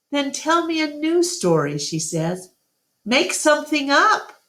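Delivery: a little elephant begging for a story; pleading child. A narrator's reading. Ruby urges Ivan to invent a story, childlike pleading.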